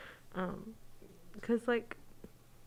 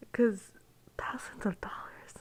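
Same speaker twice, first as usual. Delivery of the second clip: hushed, unvoiced whisper; whispering on the phone; slower than usual. Real, not acted. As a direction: whisper it so no one hears. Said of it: "I have to whisper".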